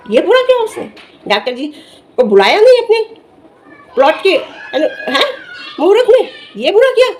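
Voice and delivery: high-pitched, disbelieving rhetorical questions; incredulous challenge. Real, not acted. Vlogger challenges critic over doctor accusations, incredulous. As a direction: throw each charge back as an absurd question.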